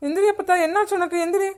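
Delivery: high, anxious urging; frightened pleading. Acted. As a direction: plead with someone to wake up. Trying to wake unconscious grandmother. (பயத்தில் கெஞ்சி எழுப்பும் குரல்)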